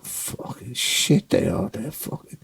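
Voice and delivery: hushed voiceless mutter of contempt; muttered from the back. Retold or imitated. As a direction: grumble under your breath, slowly. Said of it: "I could still hear [name] going".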